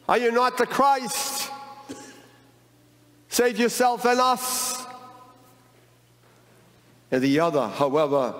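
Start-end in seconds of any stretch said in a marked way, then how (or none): 0.1-4.8 much higher, mock yell at Jesus; yelling criminal. Criminal on the cross taunting Jesus, a much higher mock yell. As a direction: taunt him from the cross, pitched way up. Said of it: "yelled at Jesus".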